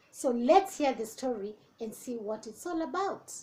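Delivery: ordinary delivery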